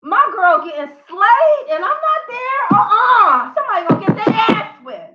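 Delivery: high-pitched indignant outburst; hammed-up incredulous anger; mock hella mad. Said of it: "He was hella mad".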